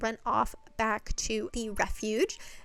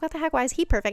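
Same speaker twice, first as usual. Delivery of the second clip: higher, louder mock-jealous protest; 5 semitones higher, 4 dB louder, much faster than usual. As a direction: imitate a jealous, indignant complaint. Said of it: "like, all jealous".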